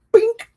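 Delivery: punch sound effect. Shown as a demonstration. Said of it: "Throw a little hip".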